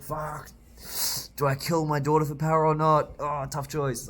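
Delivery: high, loud hammed-up panic over a choice; mock agonized villain. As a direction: shout the dilemma like a cornered villain. Count voiced agonizing over choice.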